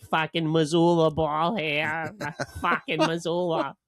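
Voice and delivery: mock Boston rant. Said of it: "the guy's nuts".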